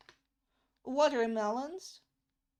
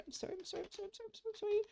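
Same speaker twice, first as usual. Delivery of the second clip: high, tiny, very soft apologies; hurried apology; 8 semitones higher, 11 dB quieter, much faster than usual. Real, not acted. Apologising for teasing British English, high and very soft. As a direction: apologise over and over in a small high voice.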